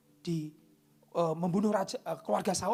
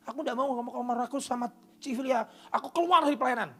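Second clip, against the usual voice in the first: sulking servant; 8 semitones higher, 7 dB louder than usual. Acted.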